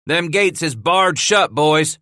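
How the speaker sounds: high, loud gloating call; loud taunt. Acted. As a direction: gloat over the gate. Bass taunting over the gate.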